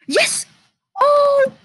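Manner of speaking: high triumphant shout; excited cheer. Real, not acted. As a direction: cheer with relief. Survives the night.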